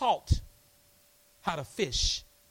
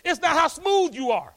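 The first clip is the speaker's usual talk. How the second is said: usual speaking voice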